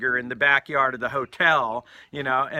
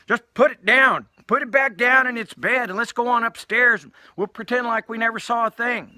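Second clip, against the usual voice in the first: loud, raised-pitch insistent orders; urgent cover-up; 9 semitones higher, 7 dB louder than usual. Retold or imitated. Storyteller voicing Andy telling Donna to hide it, loud urgency. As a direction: push each instruction hard.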